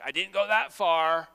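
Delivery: acted indignant excuse; defensive protest. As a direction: push back loudly, excusing yourself. Voicing listeners' defensive excuse.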